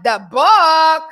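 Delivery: high, drawn-out mock rapper voice. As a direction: imitate a rapper puzzled by reading. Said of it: "she sounded like [name]".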